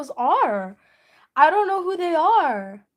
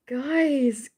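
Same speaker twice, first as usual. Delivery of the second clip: whispered plea to chat; hushed guys; 2 semitones lower than usual. Real, not acted. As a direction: breathe it out like a plea. Pleading to chat.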